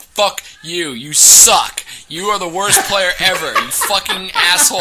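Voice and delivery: loud, high, laughing mock tirade; angry rant. Player ranting at a teammate, loud, high and half laughing. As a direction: tear into him, half laughing. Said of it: "went off on this super long rant".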